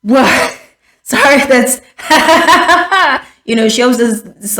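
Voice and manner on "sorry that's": imitating a cackle mid-sentence; mock crazy laughing. Mocking the vice president's habit, breaking into a crazy cackle. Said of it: "like, crazy laughing".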